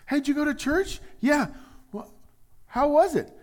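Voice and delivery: high, eager questioning friend; a friend eagerly asking how church went, high and bright; curious friend. Acted. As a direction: pipe up like a nosy buddy.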